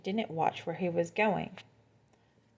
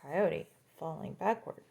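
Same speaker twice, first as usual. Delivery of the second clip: ordinary delivery, nothing marked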